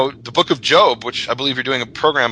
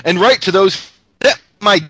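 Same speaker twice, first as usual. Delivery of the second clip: higher, louder mock Australian reading; Australian accent; 8 semitones higher, 4 dB louder than usual. Guest reading Proverbs to the panel, in an Australian accent. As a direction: keep up the put-on Aussie accent. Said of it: "can I do it in an Australian accent".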